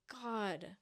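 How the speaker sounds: usual speaking voice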